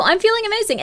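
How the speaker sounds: bright, high pitched hype; hyped-up self-talk. Pretend. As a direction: pump yourself up with it. Hyping yourself up example.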